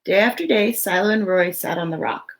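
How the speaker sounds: lively, expressive storybook read; reading to children. Acted. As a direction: read it out to a room of little kids. Reads picture book aloud.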